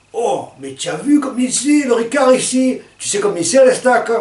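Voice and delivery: high, loud mock Marseille accent; a friend from Marseille bragging in a Marseille accent. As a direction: ham up Gérard's Marseille accent. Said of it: "qui était un Marseillais".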